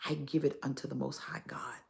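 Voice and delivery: whisper. Real, not acted. Devout dedication to God.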